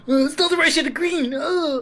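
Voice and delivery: high, nasal-free mocking whine of a friend's complaint; mocking imitation. Mocking the friend's complaint about the shade of green, in a high put-on voice. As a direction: mimic the friend high and pedantic.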